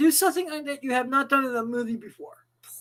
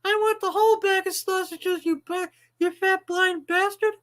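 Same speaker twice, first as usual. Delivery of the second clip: higher-pitched whiny pet voice complaining; host voices his dog griping about its owner, higher whiny pet voice; 6 semitones higher than usual. Pretend. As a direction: play the aggrieved dog, pitched up.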